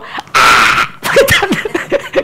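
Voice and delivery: put-on high, slow whiny child. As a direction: whine it like a stubborn little girl. Child's stubborn answer.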